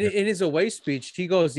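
normal talking voice

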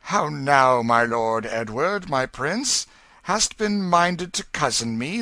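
put-on old king teasing his son gently; gentle king. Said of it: "his face grew gentle".

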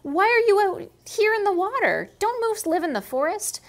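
high, loud snake voice; curious snake. Acted. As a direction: ask the moose eagerly. Snake questioning the moose.

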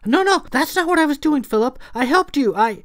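high, loud, rushed objection; frantic protest. A narrator's reading. Clara protests to Philip before he shushes her, frantic. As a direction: blurt out your defence, high and urgent.

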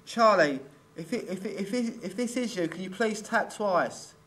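calling out loud. Real, not acted. Calling loudly to the spirit.

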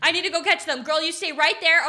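normal talking voice

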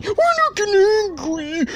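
strained denial of anger. Real, not acted. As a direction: insist you're calm as the pitch climbs.

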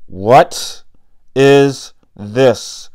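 loud angry growl, rising at the end. A narrator's reading. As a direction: growl it at the boy. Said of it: "growled the priest".

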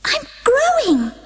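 Alice explaining to the Dormouse; Alice apologetic. A narrator's reading. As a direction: plead helplessly. Alice voiced.

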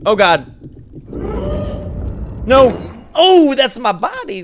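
high, loud startled cry; scared shriek. Real, not acted. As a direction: shriek in sudden fright. Sudden scare in horror game.

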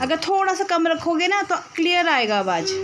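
loud and high so a deaf ear catches it. Real, not acted. To the hard-of-hearing uncle, loud and high. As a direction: call out clearly to someone hard of hearing. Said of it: "ज़ोर ज़ोर से चिल्ला चिल्ला के बोलना पड़ता है".

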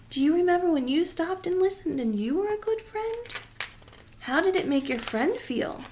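put-on tender aside to a child; soft question to child. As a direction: coax the listener to remember. Asks child listener.

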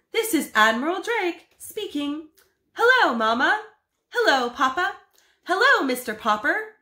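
high, loud announcer greeting; radio admiral. Acted. Admiral greets family from the South Pole, radio announcer. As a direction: call out over the airwaves. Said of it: "a faint voice floated out".

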